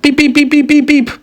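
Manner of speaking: insistent high-pitched beeping; rapid squeaky horn toots; car horn beeps. Acted. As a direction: imitate a horn honking repeatedly. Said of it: "Then the car said".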